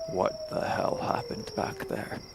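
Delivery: slow, hoarse near-whisper. Acted. Player's character confronts Cudgel held close, hoarse and hushed. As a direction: rasp it out slowly, almost without voice. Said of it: "in a very hoarse voice at this point".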